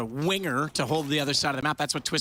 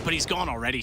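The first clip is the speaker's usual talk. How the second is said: normal talking voice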